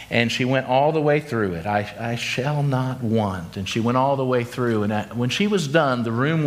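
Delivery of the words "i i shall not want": shaky voice. Retold or imitated. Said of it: "began in a shaky voice".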